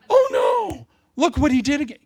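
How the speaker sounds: laughing mock dismay; mock shocked God. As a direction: pretend God is scandalised. God gasping at a sinner in heaven.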